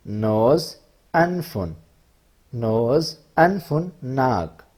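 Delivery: slow, clear word-by-word recitation; vocabulary drill. Shown as a demonstration. Teacher reciting body words to students, slow and clear. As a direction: spell each word out for the class.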